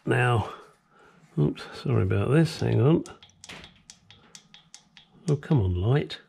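ordinary delivery

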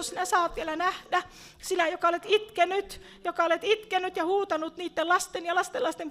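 high, loud, full-force prophetic proclamation; impassioned prophecy to a praying grandmother; prophetic preaching. Real, not acted. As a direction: declare it over her like a prophet. Prophetic word to a believer.

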